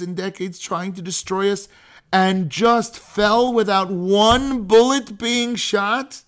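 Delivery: high-pitched rhetorical disbelief; loud incredulous questioning, slowing at the end. Acted. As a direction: raise the pitch as if you can't believe it. Mock disbelief at doubters.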